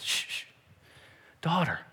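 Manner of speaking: soft, soothing address; quiet her gently; tender hushing. Acted. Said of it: "and he says".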